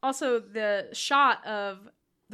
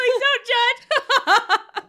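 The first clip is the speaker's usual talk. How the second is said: giggling, much higher and louder, very slow; laughing; 13 semitones higher, 11 dB louder, much slower than usual. Real, not acted. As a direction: laugh through an embarrassed plea.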